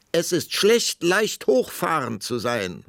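high, loud, drawn-out parting shot; calling after him. A narrator's reading. Queen to departing king, calling after him. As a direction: call it after someone walking off. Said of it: "rief die Königin ihm nach".

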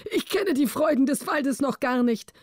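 high-pitched king, amazed; high royal exclamation without shouting; king exclaiming. A narrator's reading. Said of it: "rief er".

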